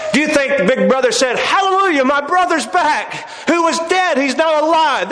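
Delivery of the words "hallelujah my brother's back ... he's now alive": higher-pitched pretend jubilation; mock rejoicing. Preacher imagines the elder brother welcoming the prodigal, mock rejoicing. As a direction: exclaim it high, as if overjoyed.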